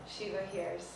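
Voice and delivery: ordinary delivery, nothing marked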